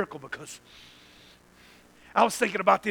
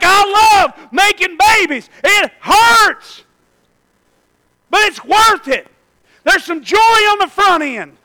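slow, loud shout, much higher; Eve's joyful cry; 13 semitones higher, 17 dB louder, slower than usual. Retold or imitated. Eve after birthing Cain and Abel, shouting joyfully. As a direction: exult through the pain. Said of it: "she's like".

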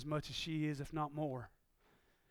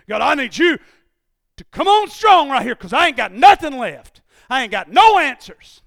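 loud, high pleading to God; preaching prayer; 8 semitones higher, 23 dB louder than usual. Acted. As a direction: cry out to God with nothing left. Preaching at full force.

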